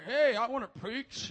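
slow put-on character voice; drawn-out eager announcement in a comic voice; Old Willie. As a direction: play an odd walk-in, drawing the words out. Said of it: "we'll call him Old Willie".

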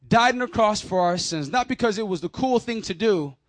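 ordinary delivery

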